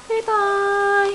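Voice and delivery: high calling out. Real, not acted. Calling to the baby.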